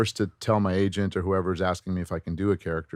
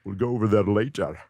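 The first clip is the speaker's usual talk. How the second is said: Scottish accent with shushed S's; all the pieces of the voice match together; Sean Connery. Shown as a demonstration. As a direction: try the suave Scot, S turning to SH. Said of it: "you put all the pieces together".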